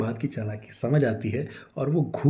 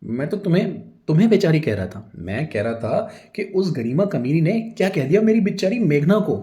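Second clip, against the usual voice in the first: loud, higher flustered excuse; explaining himself; 7 semitones higher, 7 dB louder than usual. A narrator's reading. Husband defending himself to angry wife, flustered and loud. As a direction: scramble to talk your way out. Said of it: "सफाई देते हुए बोलता है".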